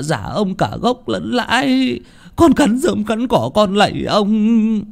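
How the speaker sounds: high, slow, wailing plea; pleading old peasant. Acted. Old Câu begs master Chánh for mercy, high wailing plea. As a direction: beg for mercy in a high quavering wail.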